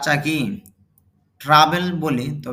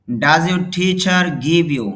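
very slow, louder, every word spelled out; slow model reading; 3 semitones higher, 6 dB louder, much slower than usual. Shown as a demonstration. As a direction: dictate it word by word for learners. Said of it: "teacher models English sentence slowly". (ধীরে ধীরে জোরে পড়া)

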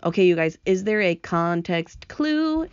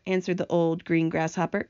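usual speaking voice

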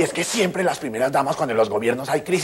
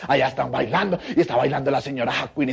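usual speaking voice

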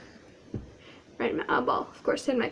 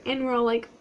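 usual speaking voice

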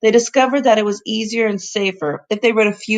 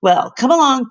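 rallying call; 5 semitones higher, 4 dB louder than usual. Acted. Professor rallies the children.

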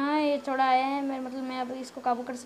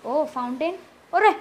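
ordinary talk

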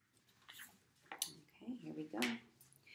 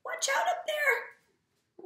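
very high, loud, drawn-out cry; hammed-up alarmed storybook shout; urgent warning; 9 dB louder, slower than usual. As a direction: call out a warning to someone up high. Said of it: "he tries to warn him and he says".

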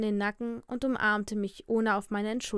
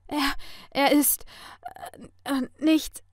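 high, halting, broken speech; shocked stammering; 5 semitones higher than usual. A narrator's reading. She tries explaining the call, stammering in shock. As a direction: stumble over the words in shock.

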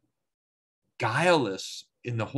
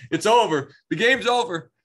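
laughing, higher and louder; game over, said through a laugh; laughing excitedly; 10 semitones higher, 6 dB louder than usual. Real, not acted. Amused teacher laughs at Luther's panic, laughing and loud.

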